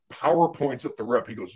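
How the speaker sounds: normal talking voice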